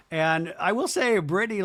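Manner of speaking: ordinary delivery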